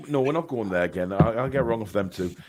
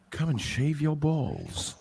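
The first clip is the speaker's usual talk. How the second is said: creepy ad voice; 2 semitones higher, 4 dB quieter, slower than usual. Retold or imitated. Mimicking the creepy ad voice.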